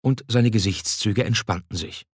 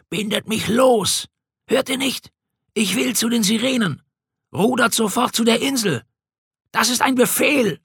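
much higher, desperate pleading shout; a bound king crying out for the Sirens, much higher; frantic shouting; 13 semitones higher than usual. A narrator's reading. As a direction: strain against the ropes and yell to the crew. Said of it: "Er schrie immer lauter".

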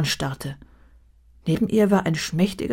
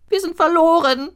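high, loud lament, wir pushed hard; a sorrowful dwarf wailing out his despair; loud high whining moan; 12 semitones higher, 6 dB louder than usual. A narrator's reading. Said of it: "jammerte der Zwerg".

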